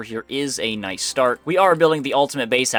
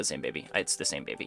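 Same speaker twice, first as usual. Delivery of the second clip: dropped low and much softer; quiet resigned; 9 semitones lower, 11 dB quieter, faster than usual. Real, not acted. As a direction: settle it with a resigned, quiet sigh of a line. Concluding that it's the same baby.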